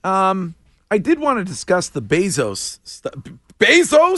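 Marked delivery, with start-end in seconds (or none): 3.6-4.2 surprised question. Pretend. Mocking the pronunciation.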